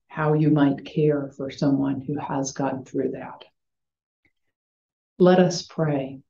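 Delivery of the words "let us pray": ordinary delivery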